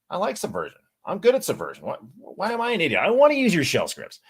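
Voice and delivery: high-pitched indignant protest; mock indignant. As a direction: protest like a wounded, insulted user. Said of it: "I was insulted".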